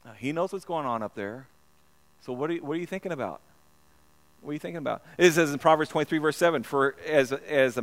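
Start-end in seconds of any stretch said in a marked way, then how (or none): none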